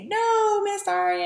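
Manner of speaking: put-on high, drawn-out kids' chorus; children answering. Students answering the bunny question, high imitated chorus. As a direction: imitate little pupils in a high singsong. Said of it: "then they say".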